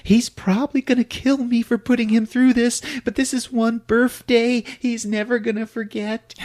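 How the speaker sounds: high-pitched mock woman voice; hammed-up high character voice; wife's voice. Wife's role in retold film.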